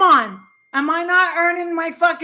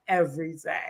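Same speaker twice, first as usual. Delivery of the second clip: imitating her friend; 11 semitones lower, 11 dB quieter than usual. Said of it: "And you're like".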